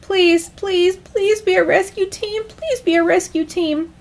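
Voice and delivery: high, urgent muttered prayer; anxious high-pitched begging; desperate praying. Acted. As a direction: repeat it like a frantic plea. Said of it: "Calvin prayed to all the gods".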